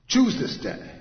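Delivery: loud, high, sharp command. Acted. Joshua giving his people the word, loudly commanding. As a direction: order it with full authority. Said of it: "Just this commanding word".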